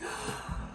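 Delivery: soft unvoiced gasp of delight; breathy surprised gasp. Real, not acted. Vlogger pulling Minnie out, breathy gasp. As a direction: catch your breath in surprise.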